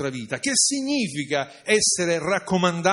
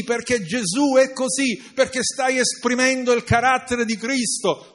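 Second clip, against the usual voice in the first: higher, louder pulpit declaration; fervent preaching; 6 semitones higher, 5 dB louder than usual. Acted. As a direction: proclaim God's endorsement. Preacher voices God, full force.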